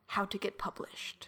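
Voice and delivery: whispered search query. Pretend. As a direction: whisper it like a secret.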